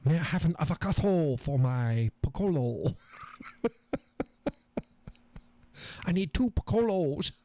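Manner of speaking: high, hammed-up attempt at a Japanese accent; mock Japanese accent. Playfully ordering avocado and piccolos, mock Japanese accent. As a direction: keep up the silly Japanese accent. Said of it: "Oh, that's Japanese".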